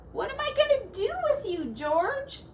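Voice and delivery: high, fast exasperated scold. Acted. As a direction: tell the pig off. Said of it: "Miss Rosemary scolded".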